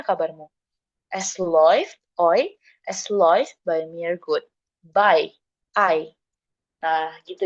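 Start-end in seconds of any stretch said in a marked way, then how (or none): 1.1-2.6 "oi" diucapkan pelan dan jelas; bunyi oi. Shown as a demonstration. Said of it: "jadinya dia dibaca oi". 4.9-6.1 "By" lalu "I" diucapkan terpisah; ei dibaca ai. Shown as a demonstration. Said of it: "dia dibacanya jadi I".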